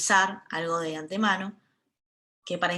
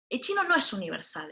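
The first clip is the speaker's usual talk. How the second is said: normal talking voice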